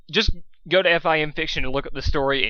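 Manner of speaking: ordinary delivery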